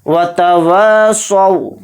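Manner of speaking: slow, drawn-out tajweed chant; Quran recitation. Shown as a demonstration. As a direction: recite it slowly and melodically. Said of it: "वा थोड़ा खींच कर पढ़ेंगे".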